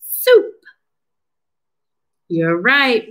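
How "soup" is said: "Soup" as one high, loud, short beat; single clapped syllable. Shown as a demonstration. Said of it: "one syllable".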